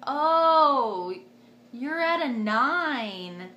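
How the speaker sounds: loud, slow mock amazement; retold surprise. Nurse announcing the dilation check, drawn-out mock surprise. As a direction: stretch each word out in exaggerated surprise.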